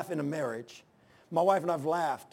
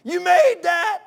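high, loud awestruck shout; yelling out; 12 dB louder, slower than usual. Retold or imitated. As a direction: shout it out the truck window at the mountains. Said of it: "I'd be yelling out".

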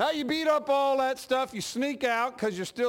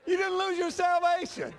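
mocking line with laughter left over; still laughing; 8 semitones higher than usual. Real, not acted. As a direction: repeat it through a chuckle. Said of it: "I started laughing".

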